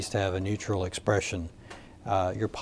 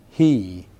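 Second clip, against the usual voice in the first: "he" punched out loud, set apart by a pause; stress on "he"; 7 dB louder than usual. Shown as a demonstration. Trainer showing how emphasis changes meaning, stress on "he". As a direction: lean hard on the word "he". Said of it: "If I emphasize".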